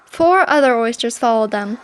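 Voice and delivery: ordinary delivery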